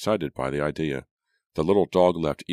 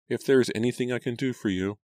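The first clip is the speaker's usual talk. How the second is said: timid maid; 8 semitones higher, faster than usual. A narrator's reading. Maid offering help, eyes lowered.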